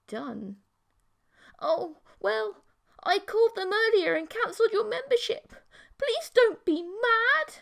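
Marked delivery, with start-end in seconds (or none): 1.6-7.6 put-on high, pleading girlfriend voice; girlish pleading voice.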